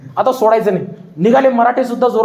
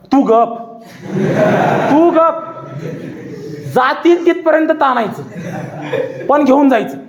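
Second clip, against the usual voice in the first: angry rebuke; 3 semitones higher than usual. A narrator's reading. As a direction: cut him off sharply. Khan silences his sardar.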